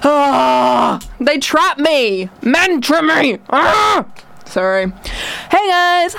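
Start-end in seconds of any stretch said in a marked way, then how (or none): 0.0-4.0 hammed-up anguished wail, drawn out; mock-tormented cries; passionate outburst. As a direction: lament it like a tragic captive, slowly rising. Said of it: "I got a bit passionate there".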